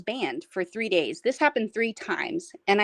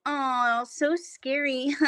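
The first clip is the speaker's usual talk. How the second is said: high, drawn-out "so scary" with a taunting laugh; mocking fake fear; 7 semitones higher, much slower than usual. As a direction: mimic bullies pretending to be spooked. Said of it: "they would mock me".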